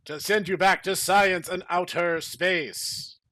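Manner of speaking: loud, angry retort; angry yell. Acted. Henry Freeman yells at the Combine army, angry. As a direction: yell it back with anger. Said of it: "Henry Freeman yelled with mad".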